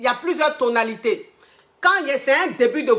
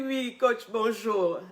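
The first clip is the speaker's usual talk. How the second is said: soft, cold and distant greeting; 8 dB quieter, much slower than usual. Retold or imitated. Client picking up the coach's call, cold and much softer. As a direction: answer the coach quietly and coldly. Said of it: "j'entends une voix glaciale, une voix froide".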